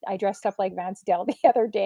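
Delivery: ordinary talk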